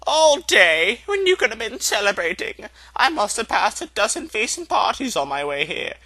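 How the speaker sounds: mock astonishment, teasing her; amused, lilting wizard's reply; Dumbledore's voice. Dumbledore answers McGonagall.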